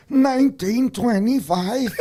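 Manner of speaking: put-on high, slow, loud old man voice. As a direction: answer like a frail old man. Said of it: "I was ninety-eight years old".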